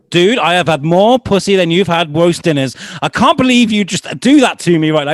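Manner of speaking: high, loud indignant outburst, retold; ballistic. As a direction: imitate an outraged friend going off. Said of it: "He went absolutely ballistic at me".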